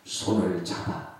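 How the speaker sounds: usual speaking voice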